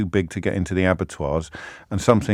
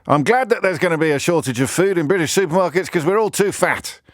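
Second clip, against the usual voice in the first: higher, pompous columnist voice; mock Daily Mail columnist; 8 semitones higher than usual. Pitching a Daily Mail column in a higher, smug parody voice. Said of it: "column in the Daily Mail".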